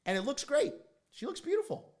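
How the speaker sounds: high, loud gushing praise; excited gushing. Real, not acted. Son praises his mom's facelift to viewers, high excited gush. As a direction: rave about how she looks.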